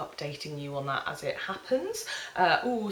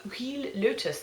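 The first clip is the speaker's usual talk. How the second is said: French accent; 6 semitones higher than usual. Shown as a demonstration. Vlogger naming a Clarins oil, proud A-level French accent. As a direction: roll out Huile Lotus like a French schoolgirl. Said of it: "I'm loving using my A-level French accent".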